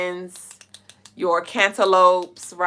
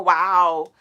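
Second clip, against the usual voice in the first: ordinary delivery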